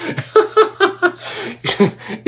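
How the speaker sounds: a long high cackle; the laugh that annoys the haters; real mocking laugh. Real, not acted. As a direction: laugh right at the rival fans. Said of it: "Nabubwisit nga sila sa tawa ko".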